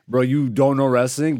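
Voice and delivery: ordinary delivery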